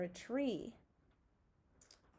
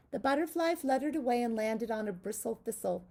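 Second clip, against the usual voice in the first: usual speaking voice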